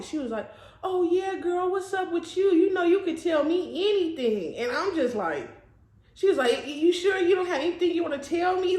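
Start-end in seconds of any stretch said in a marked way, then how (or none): 0.8-4.6 high, over-friendly mock girlfriend voice; prying fake-friendly girl. Girl fishing for info from her friend, mock over-friendly. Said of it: "like, the way she was talking". 6.7-8.8 high, coaxing imitation of a nosy friend; prying fake-friendly girl. Homegirl trying to get some info, mock coaxing. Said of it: "just talking like that".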